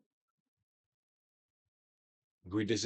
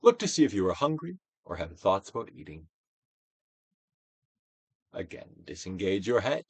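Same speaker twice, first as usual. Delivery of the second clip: guided meditation; 4 dB louder than usual. Acted. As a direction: coax the listener to let feelings pass.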